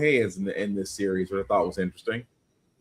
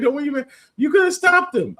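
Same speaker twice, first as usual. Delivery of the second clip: incredulous; 14 semitones higher, 4 dB louder than usual. Retold or imitated. Voicing incredulous reaction to comic.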